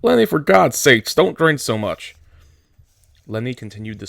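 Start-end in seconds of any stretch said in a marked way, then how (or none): 0.0-2.1 higher and louder, urgent scolding; scolding shout. Acted. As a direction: yell at him to stop drinking. George scolds Lenny drinking too much.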